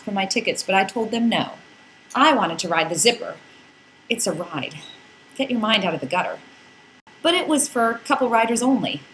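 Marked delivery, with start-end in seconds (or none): none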